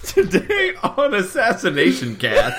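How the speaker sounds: high, slow shouted show intro; Guy Fieri as Shrek. Pretend. Cohost joining the fake show intro, shouty mock impression. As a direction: belt it like an ogre TV host. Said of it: "Guy Fieri as Shrek".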